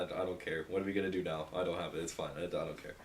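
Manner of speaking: ordinary talk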